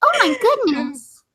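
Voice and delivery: put-on high girly doll voice; baby doll voice. Shown as a demonstration. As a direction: coo sweetly like a talking doll. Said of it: "My baby doll voice going".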